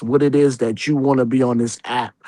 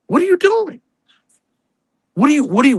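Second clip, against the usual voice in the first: much higher, incredulous "doing"; exasperated outburst; 13 semitones higher, faster than usual. Real, not acted. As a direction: shriek the question in disbelief.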